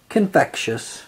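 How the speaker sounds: plain reading voice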